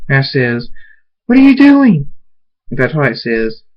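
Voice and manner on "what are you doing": high, loud shocked cry; alarmed. A narrator's reading. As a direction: shout it in rising panic. Alarm at what he does.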